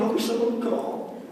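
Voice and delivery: high, soft wistful voice; wistful wishing. Acted. Waiting at home for a call, wistful. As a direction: wish aloud in a small high voice.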